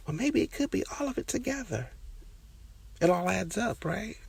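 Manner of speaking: very high put-on voice, half joking; mock high voice. Mocking her own messy look to listeners, in a very high voice. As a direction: squeak it out like a sarcastic aside.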